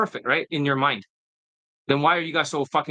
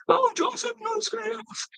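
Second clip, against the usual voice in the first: much higher whining falsetto, mocking; whiny mockery; 3 dB quieter, slower than usual. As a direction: mimic excuse-makers as whiny children. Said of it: "That's how they sound like".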